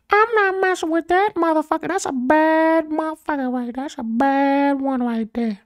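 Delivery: squawky parrot voice, very high; parrot impression. Motivational speaker doing a parrot voice. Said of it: "That's my parrot impression".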